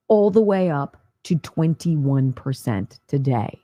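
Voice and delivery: normal talking voice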